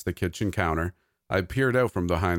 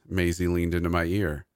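ordinary delivery, nothing marked

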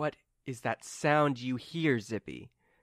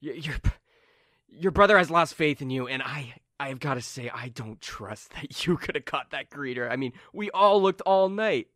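raised, exasperated henchman voice; doubting henchman; 3 semitones higher, 5 dB louder than usual. Acted. Limbo member doubts Carmen caught the greeter, exasperated. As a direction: voice a doubtful, complaining crony. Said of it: "and they're like".

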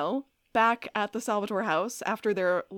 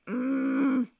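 Damon struggling; slower than usual. Retold or imitated. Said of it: "struggling with hearing this".

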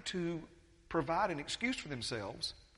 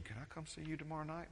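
hushed, secretive request, much softer; whispering; 2 semitones higher, 7 dB quieter, faster than usual. Retold or imitated. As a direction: lean in and ask it quietly, like a secret.

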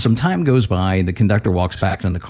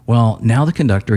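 usual speaking voice

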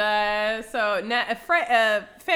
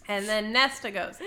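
ordinary delivery

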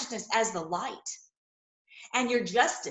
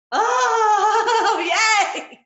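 laughing cheer; 10 semitones higher, 9 dB louder than usual. Real, not acted. Preacher cheers at the promise.

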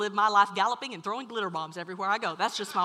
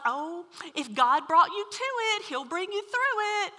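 mock pious quip; 8 semitones higher, 4 dB louder than usual. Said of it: "these little quips like".

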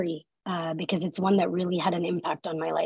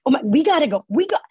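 higher, louder flustered rush; panicked hurry; 6 semitones higher, 6 dB louder than usual. Retold or imitated. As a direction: blurt it out to get away. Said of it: "And I was like".

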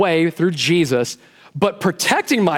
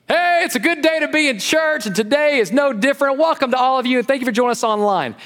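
a hint of a cheery pastor welcome, higher; mock greeting; 8 semitones higher than usual. Pastor mocks a short service, light cheery welcome. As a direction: breeze through a too-easy welcome. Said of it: "that'd save me hours of prep time".

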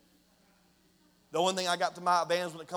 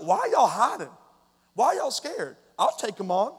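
higher, louder incredulous challenge; bold challenge; 5 semitones higher, 5 dB louder than usual. Acted. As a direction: call out the cowards, pitch jumping up. David challenges the hiding army.

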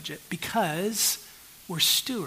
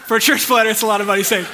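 loud, higher, through laughter; laughing aside; 4 semitones higher, 11 dB louder, faster than usual. Real, not acted. Pastor joking to congregation about church planter savings, laughing. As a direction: laugh it out, raised over the room.

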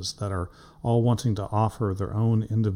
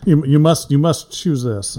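mock command; 4 semitones higher, 8 dB louder than usual. Host jokes guest is forced to pick friend's comic, mock command. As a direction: playfully order them into submission.

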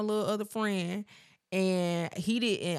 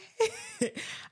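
high laugh; 4 dB quieter than usual. Real, not acted. Recalling crying after appointment.

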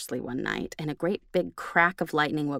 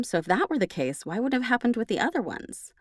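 normal talking voice

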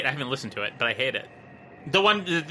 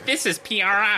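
Ira Glass impression; 6 semitones higher than usual. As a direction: do a basic nerd voice. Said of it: "Just basic nerd voice".

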